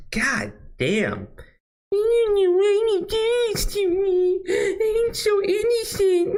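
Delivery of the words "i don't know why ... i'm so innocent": mock crying whine. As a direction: whimper it like a self-pitying victim. Mocking victim whine again.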